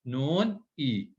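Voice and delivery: very slow, each letter drawn out; stretched teaching syllables; slow spelled-out reading. Shown as a demonstration. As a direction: spell it out slowly for the pupil. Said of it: "इसको पहचानने की कोशिश करें आप".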